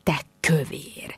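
breathy spiteful whisper, slowed down; whispered retort. Pretend. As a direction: hiss the insult under your breath. Imagined cutting reply to Fiona.

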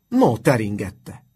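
loud, drawn-out outburst of disbelief; exclaiming shout. A narrator's reading. Teacher exclaiming at his pupil, a loud shout. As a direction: cry it out slowly and forcefully. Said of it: "kiáltott Justus".

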